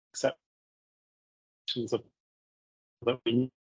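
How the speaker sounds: choppy dropping connection; radio breaking up. Real, not acted. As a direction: voice cutting out on a bad line. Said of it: "Are you breaking up a little bit there".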